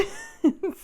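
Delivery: high, real burst of laughter; real giggle; short laugh. Real, not acted. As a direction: laugh at your own confusion. Laughs after baffled what.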